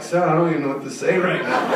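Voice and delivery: stunned reply through laughter; laughing. Retold or imitated. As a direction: laugh helplessly at him. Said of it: "I'm like".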